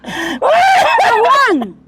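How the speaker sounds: high, crying wail of his name; worried wail at her friend; panicked cry. Acted. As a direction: cry out in alarm.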